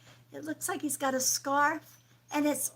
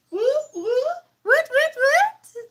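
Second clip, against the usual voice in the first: high, loud celebratory woots; cheering; 12 dB louder, slower than usual. Real, not acted. Streamer cheering loudly for a two-dollar donation. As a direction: whoop it up for the donor.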